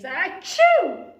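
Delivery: a loud high sneeze; big theatrical achoo. Acted. Betty sneezing over the cauldron, loud sneeze. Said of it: "which made Betty sneeze".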